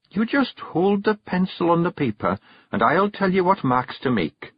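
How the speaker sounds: high Scots wife, instructing; a wife guiding her husband's hand; patient Kelda. Acted. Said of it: "said Jeanie".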